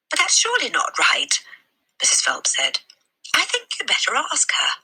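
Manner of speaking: high, breathy librarian voice; a concerned lady asking gently; kindly librarian. A narrator's reading. Mrs Phelps voiced.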